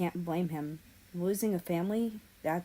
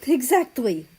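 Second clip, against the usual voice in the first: sharp, loud, high "Exactly!"; exclaiming; 6 semitones higher, 10 dB louder than usual. A narrator's reading. Narrator exclaims to Ukraine, loud and high. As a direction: burst out in agreement. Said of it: "I exclaimed".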